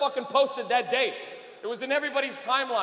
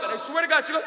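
ordinary talk

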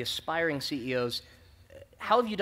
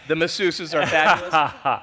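laughing; 2 semitones higher, 9 dB louder, slower than usual. Real, not acted. Joking about DocuSign perks.

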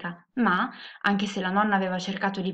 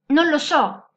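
louder, higher burst; exasperated yell; 6 semitones higher, 7 dB louder, slower than usual. A narrator's reading. Woman yells back at the elf's question, exasperated shout. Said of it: "urlò la donna".

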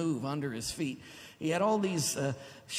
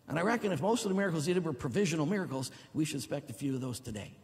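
fast preaching; faster than usual. Real, not acted. Driving the point home.

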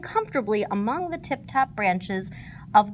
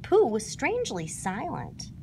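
ordinary delivery, nothing marked

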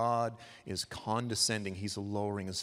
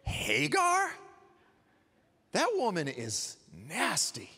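higher, half-mocking brush-off of his wife; dismissive husband; 8 semitones higher, slower than usual. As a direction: toss her name aside like a man finally taking charge. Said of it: "and he should have said".

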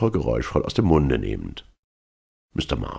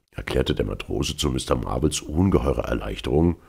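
plain reading voice

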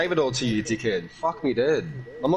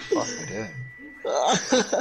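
exasperated; 5 semitones higher, 4 dB quieter, much slower than usual. Real, not acted. Exasperated drawn-out curse.

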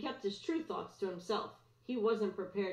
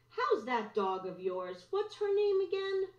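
falsely sweet; 10 semitones higher, 4 dB louder than usual. A narrator's reading. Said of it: "something false in Griffin's tone".

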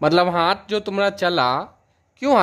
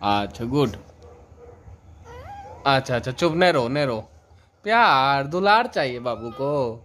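soothing hush; 4 semitones lower than usual. Real, not acted. Hushing crying baby. (रोती बच्ची को धीरे से चुप कराना)

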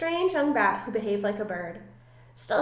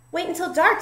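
high, louder eager cry; excited; 8 semitones higher, 5 dB louder than usual. A narrator's reading. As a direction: burst out with eager anticipation. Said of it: "Stella Luna said excitedly".

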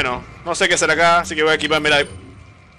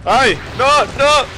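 startled cry; 9 semitones higher, 6 dB louder, slower than usual. Real, not acted. Sudden scare in game.

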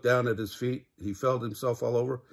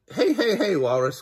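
calling out; 8 semitones higher, 5 dB louder than usual. A narrator's reading. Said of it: "called Pig".

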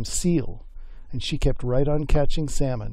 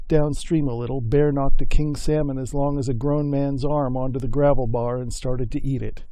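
ordinary delivery, nothing marked

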